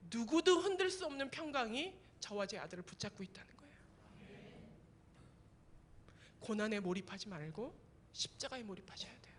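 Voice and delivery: soft, unvoiced pastoral hush; whispered sermon climax; intimate whisper. Real, not acted. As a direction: lean in and whisper the truth. Preacher drops to intimate whisper.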